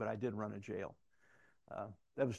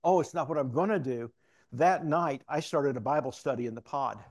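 louder, eager imitation of a new convert; animated; 2 semitones higher, 7 dB louder than usual. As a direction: burst out with the news as the excited inmate. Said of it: "he becomes so animated".

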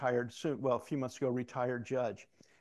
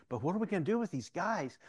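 higher-pitched, urgent retold plea; animated; 6 semitones higher than usual. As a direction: imitate the eager inmate pressing his question. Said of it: "he becomes so animated".

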